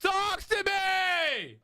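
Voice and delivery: drawn-out, high, excited yell; hype shout. Real, not acted. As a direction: call it out slow and pumped. Hyped shout at the wheel spin.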